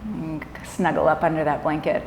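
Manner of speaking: calm yoga nidra guidance with long pauses; guided meditation. Acted. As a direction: ease them gently into rest, leaving space between phrases.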